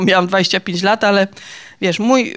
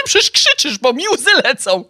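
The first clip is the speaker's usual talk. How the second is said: tearful high complaint; 4 semitones higher than usual. Retold or imitated. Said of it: "Ja mówię".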